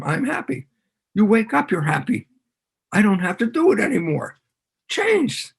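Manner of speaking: high, delighted mock realization; sing-song, high-pitched put-on joy; hammed-up happiness. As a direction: act out a sudden happy transformation. Acts out being newly happy.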